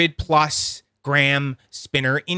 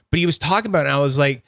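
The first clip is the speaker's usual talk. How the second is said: normal talking voice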